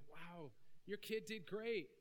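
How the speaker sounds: high, slow, beaming compliment; light up for the proud parent; hammed-up cheerful congratulations; mock gushing praise. Praising in acted scene.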